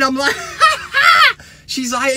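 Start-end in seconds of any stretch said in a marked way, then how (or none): 0.4-1.3 high, loud cackle; shrieky retold belly laugh; re-enacted laughing fit. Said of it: "And I'm laughing".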